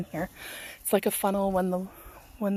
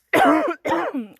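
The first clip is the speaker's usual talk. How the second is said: sharp real cough from a swallowed bug; hard cough; 12 semitones higher, 11 dB louder than usual. Real, not acted. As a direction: cough hard to clear the throat. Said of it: "just swallowed a fly".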